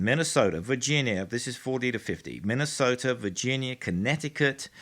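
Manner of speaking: ordinary talk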